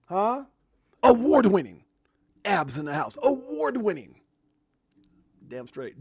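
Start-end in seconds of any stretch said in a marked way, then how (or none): 1.0-1.8 booming announcer call. Shown as a demonstration. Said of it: "That sounds way better". 3.2-4.2 punchy announcer call, award on top. Shown as a demonstration. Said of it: "That sounds way better".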